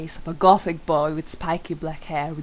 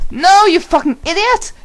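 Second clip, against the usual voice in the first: much higher and louder, furious yell; shouting; 13 semitones higher, 11 dB louder than usual. A narrator's reading. Narrator screams at Draco in fury. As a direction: scream the insult at him. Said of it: "I shouted".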